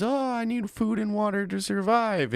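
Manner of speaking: high mock whiny complaining voice; whiny woman imitation. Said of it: "It's always".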